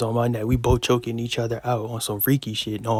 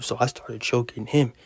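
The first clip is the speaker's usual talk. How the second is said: usual speaking voice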